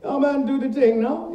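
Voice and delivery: quick high slangy Bajan-ish quip; casual street voice. Retold or imitated. Actor showing his character drop the posh, casual. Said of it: "he goes from very, very posh to".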